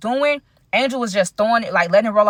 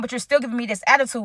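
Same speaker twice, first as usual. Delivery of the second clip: normal talking voice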